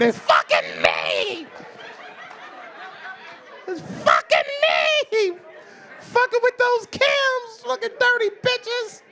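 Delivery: drawn-out, high comic scream; screamed mock panic. Comic realising he is the one infected, screamed mock panic. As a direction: scream it slowly in hammed-up despair.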